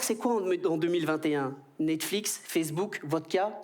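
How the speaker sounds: normal talking voice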